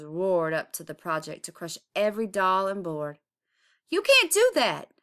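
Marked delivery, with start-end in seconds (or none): 3.9-4.9 high, loud protesting yell; angry neighbors shouting. Acted. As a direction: yell at the bulldozers. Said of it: "the neighbors yelled".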